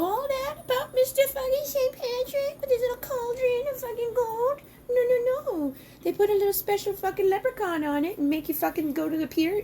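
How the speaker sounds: mock the saint in a squeaky sneer; mocking rant. Real, not acted. Mocking Saint Patrick in high voice.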